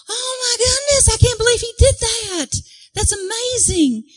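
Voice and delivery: very high squealed amazement; mock high-pitched marveling; astounded crowd. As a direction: squeal like a thrilled onlooker.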